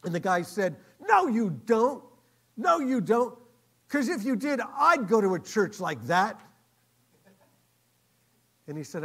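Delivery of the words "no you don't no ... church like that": loud, high, forceful retort; emphatic disbelief. Retold or imitated. Diner owner disputing the man's answer, emphatic. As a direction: contradict him at full voice.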